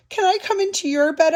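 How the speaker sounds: high, pleading little voice; little mouse asking. A narrator's reading. Little Mouse asking to share the bed, high plea. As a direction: ask high, like a small mouse. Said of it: "asked Little Mouse".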